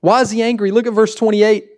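ordinary delivery

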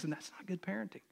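hushed admission to the room; whispered aside. Real, not acted. Pastor whispering an admission to his congregation. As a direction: whisper it like a confession.

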